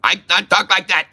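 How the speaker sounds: quick, badly faked Vietnamese accent; mock Vietnamese accent. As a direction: rattle it off in a fake Vietnamese accent. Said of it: "I cannot do the Vietnamese".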